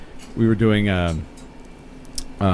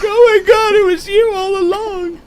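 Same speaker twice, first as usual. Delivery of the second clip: shrieked much higher and louder; hammed-up horror at the big reveal; mock horrified shriek; mock shocked gasp; 7 dB louder than usual. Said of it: "And I'm like".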